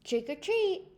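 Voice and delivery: high, loud surprise shout. Acted. Mister Mole startling Franklin in the haunted house, loud shout. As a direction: jump out and shout it. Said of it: "shouted Mister Mole".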